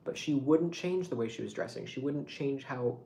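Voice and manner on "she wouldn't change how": normal talking voice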